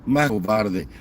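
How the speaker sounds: usual speaking voice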